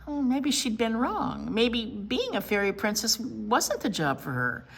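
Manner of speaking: ordinary delivery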